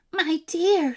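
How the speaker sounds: alarmed call to her husband; old woman crying out. A narrator's reading. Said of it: "she cried".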